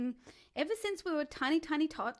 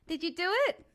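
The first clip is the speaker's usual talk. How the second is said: usual speaking voice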